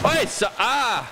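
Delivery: high scared yelp; startled scream. Real, not acted. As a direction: shriek in sudden fright. Jump scare in horror game.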